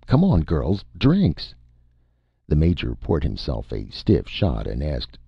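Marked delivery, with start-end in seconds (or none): 0.1-1.5 higher, anxiously coaxing call; nervous call. A narrator's reading. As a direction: hurry them along nervously, voice rising. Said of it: "Vern called nervously".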